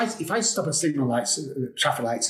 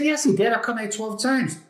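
insistent protest; 8 semitones higher than usual. Retold or imitated. Insisting against neighbor's denial.

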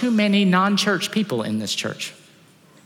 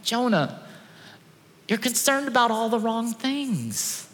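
gentle God; 4 semitones higher, 4 dB quieter than usual. Retold or imitated. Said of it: "He's like going".

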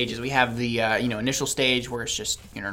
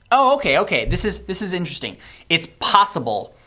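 excited; 7 semitones higher, 6 dB louder than usual. Real, not acted. Sudden realization about the cure.

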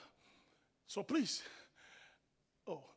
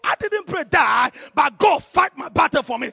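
loud, raised testimony building to a shout; thundering pulpit declaration; full-force preaching; 4 semitones higher, 17 dB louder than usual. Real, not acted. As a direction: punch out each phrase to rouse the congregation.

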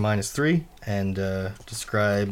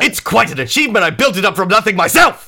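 high, loud, quick burst of pride; boastful character voice; 12 semitones higher, 10 dB louder than usual. Acted. Host showing off what he built, boastful and loud. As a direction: proclaim it loudly, pitched way up.

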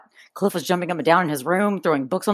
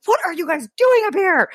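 mock angry dad; 11 semitones higher than usual.

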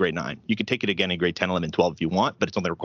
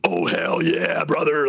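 drawn-out, louder wrestler catchphrase; Stone Cold; 5 semitones higher, 3 dB louder, much slower than usual. Retold or imitated. Teen playing with wrestling figures, mock Stone Cold wrestler voice. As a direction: stretch it out like a hyped-up wrestler. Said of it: "like a Stone Cold".

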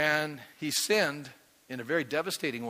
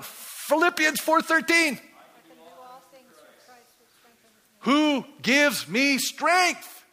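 1 preached shout; 10 semitones higher, 6 dB louder, much slower than usual. Real, not acted. Preacher calling out the verse.

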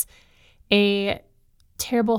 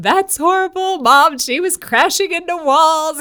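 put-on high, loud wailing kid, much higher and louder; upset little boy; 9 semitones higher, 7 dB louder than usual. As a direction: wail it like a distraught child. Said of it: "he's back in my room".

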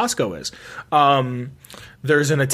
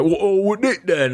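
mock Cockney; 5 semitones higher, slower than usual. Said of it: "I wasn't like ... Cockney".

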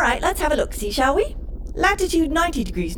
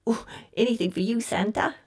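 charmed and soft; 5 semitones lower, 6 dB quieter than usual. Acted. Computer charmed by Santa.

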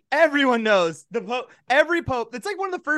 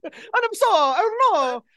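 high, put-on Italian Pope; mock Pope; 12 semitones higher than usual. The Pope denies stealing the treasure in a high put-on voice. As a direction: deny everything in a silly high voice.

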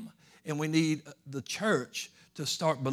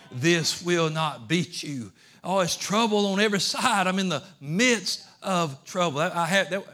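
full-force preaching; 3 semitones higher, 6 dB louder than usual. Acted. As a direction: build it like a revival preacher.

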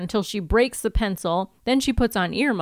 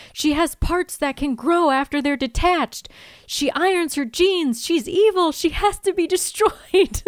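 high voice building higher, hammed-up; imitated frantic accusation; 8 semitones higher than usual. Tied-up Buffy accusing her roommate, mock frantic. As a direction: build to a mock-hysterical verdict.

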